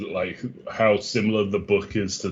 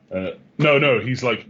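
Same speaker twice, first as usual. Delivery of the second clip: ordinary delivery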